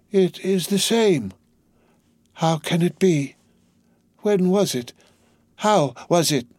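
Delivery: put-on a dazed old prisoner wondering aloud. A narrator's reading. Doctor Manette's voice.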